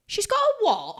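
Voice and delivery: high, loud incredulous cry; shocked disbelief. Retold or imitated. Salon worker reacting to the client's news, shocked. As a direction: blurt it out in alarmed disbelief.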